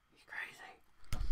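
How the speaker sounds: slow whispered aside. Acted. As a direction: breathe it slowly like a secret. Echoing his own remark.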